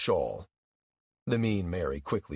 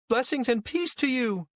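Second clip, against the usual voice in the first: high, sing-out blessing; pious greeting. A narrator's reading. Mother blessing the woman who confronts her, high pious greeting.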